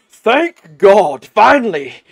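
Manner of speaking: high loud relieved cry; relieved shout. Acted. Sheep relieved to be understood.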